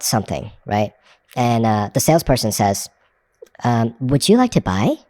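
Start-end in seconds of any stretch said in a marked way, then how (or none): 4.0-5.0 "Would you like to buy?" rising on like; question tonality. Shown as a demonstration. Salesperson asks the buyer, questioning tune. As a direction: ask it as an ordinary rising question.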